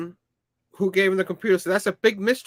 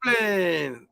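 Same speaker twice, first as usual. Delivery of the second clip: dramatic announcer; 5 semitones higher, 8 dB louder, slower than usual. Pretend. Announcing the rescuer's entrance.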